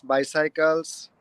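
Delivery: ordinary talk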